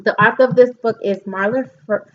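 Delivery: ordinary talk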